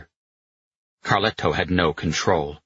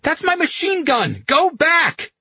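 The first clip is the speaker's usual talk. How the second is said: high, outraged shout; angry yelling; 4 dB louder than usual. A narrator's reading. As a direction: yell in furious protest at the driver. Carletto yelling over lost gun.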